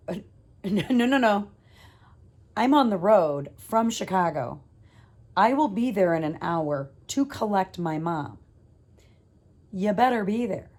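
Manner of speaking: loud, firm retort on the phone; fired up. Retold or imitated. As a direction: push back hard, raising the voice. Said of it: "now I was fired up".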